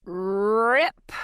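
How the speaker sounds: sharp ripping sound effect; mock tearing noise; tearing rip. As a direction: voice the rip of fabric. Sound of fabric ripped off.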